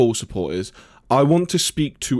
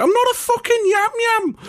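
indignant squeal, voice cracking very high; massive voice break. Retold or imitated. Birmingham workmate teased as a yam yam, voice-breaking protest. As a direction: imitate an offended cry breaking into falsetto. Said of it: "his response would just be a massive voice break".

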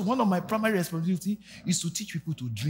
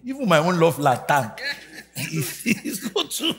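laughing; 3 semitones higher, 3 dB louder than usual. Real, not acted. Preacher joking, laughing at himself.

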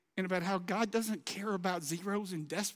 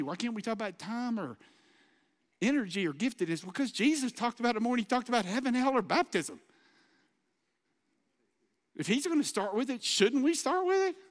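loud, fast, rising preacher drive; fast, loud preaching; full-force preaching; 4 semitones higher, 5 dB louder than usual. Acted. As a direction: hammer the point home to the congregation.

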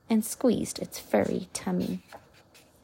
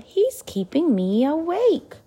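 cried; 7 semitones higher than usual. A narrator's reading. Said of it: "Sophie cried".

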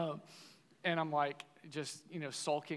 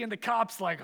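ordinary talk, nothing marked

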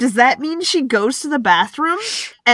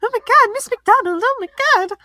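high, squealing, starstruck; gushing fangirl voice; 12 semitones higher than usual. Retold or imitated. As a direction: gush like a star-struck teen. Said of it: "she's like".

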